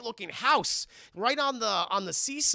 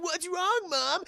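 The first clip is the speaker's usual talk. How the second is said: high-pitched worried question; mock worried son; 12 semitones higher, 4 dB louder, slower than usual. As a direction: imitate a boy calling to his mother. Voicing son answering panicked mother.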